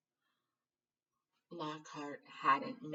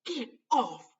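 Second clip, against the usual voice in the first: sharp startled shout; loud; 8 semitones higher, 11 dB louder than usual. A narrator's reading. Harry startled in the dark by a stranger, shouting. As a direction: shove someone off in fright. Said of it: "he said loudly".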